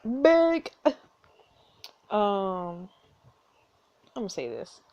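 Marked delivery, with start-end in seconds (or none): 0.0-0.7 high, loud mock demand; mock command. Player jokingly acts out ordering him to beg, mock command. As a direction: pitch it way up and louder, one drawn word.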